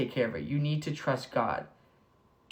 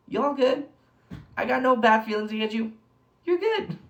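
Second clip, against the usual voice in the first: high, loud mock challenge; playful mic-drop taunt; 9 semitones higher, 5 dB louder than usual. Playing the moment after Jesus drops the mic, mock taunt. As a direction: toss it out high and cocky.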